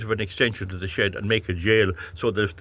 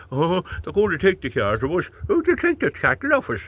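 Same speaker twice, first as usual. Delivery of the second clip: rising into a higher whiny mock voice; mock whinging voter; 8 semitones higher than usual. Mocking voters complaining about cars and cattle, whiny. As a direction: whine like a hard-done-by farmer. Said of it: "when they whinge to you".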